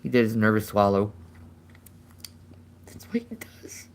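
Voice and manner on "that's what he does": whispered aside. Real, not acted. Owner whispering about the bird's nervous swallow, unvoiced. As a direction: breathe it as a secret.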